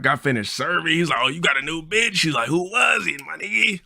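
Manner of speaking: high, nosy homie impression; pry for the name in his high voice; friend's voice. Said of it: "Yeah, that's how he talked".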